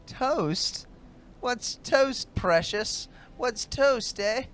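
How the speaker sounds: very high, loud, mocking madman; mad god's voice. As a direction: squeal it like a gleeful lunatic. Mad god voice mocks companion.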